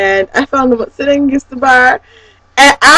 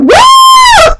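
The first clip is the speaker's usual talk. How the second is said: very high, loud "woo"; exuberant whoop; 6 dB louder than usual. Retold or imitated. Concertgoer skipping to the car, exuberant whoop. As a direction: let out a long high whoop.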